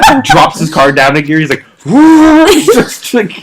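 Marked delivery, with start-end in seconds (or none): 1.9-2.5 high, loud imitated whoop of thrill; mock whoop. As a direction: whoop like a friend flooring it. Revving the car down a gear.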